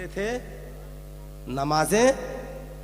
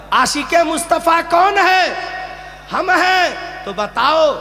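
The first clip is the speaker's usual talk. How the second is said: loud, high call-and-response preaching; rallying preacher; 12 semitones higher, 8 dB louder than usual. Real, not acted. Preacher rousing the congregation to answer, high and ringing. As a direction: fire questions out to rouse the crowd. Preacher rallies the audience with questions.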